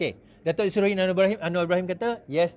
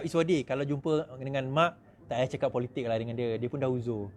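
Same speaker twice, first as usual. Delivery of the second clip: quiet son's advice; 7 semitones lower, 6 dB quieter than usual. Retold or imitated. Son's quiet request retold.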